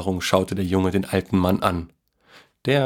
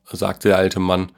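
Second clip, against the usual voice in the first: usual speaking voice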